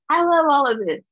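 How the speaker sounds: loud, high burst of real joy; joyful. Real, not acted. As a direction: belt it out, delighted. Joyful Juneteenth memory.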